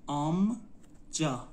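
آمجا drawn out as آم, pause, جا; slow teaching demo of آمجا, split in two; syllable by syllable. Shown as a demonstration. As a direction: sound out آمجا slowly in two parts. Teacher breaks word into syllables.

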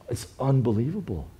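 normal talking voice

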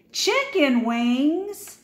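a big slow reveal of the joke. A narrator's reading. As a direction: savour the punchline slowly. The twist is revealed.

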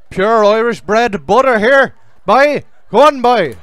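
loud, slow hammed-up Irish brogue; Irish accent. Player showing off his Irish accent to the lobby, hammed-up Irish. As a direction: lay the Irish on thick, slowly. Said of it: "Is that a real accent".

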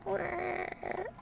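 high, drawn-out groaning zombie moan. Pretend. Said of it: "my zombie impression".

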